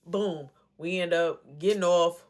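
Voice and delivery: normal talking voice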